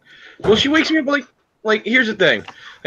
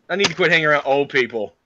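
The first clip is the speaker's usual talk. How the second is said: ordinary delivery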